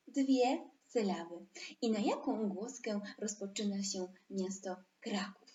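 ordinary delivery

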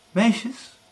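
excited mother calling high; mother's high eager voice; eager widow. Acted. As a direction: call the girls, thrilled. Widow calls her daughters.

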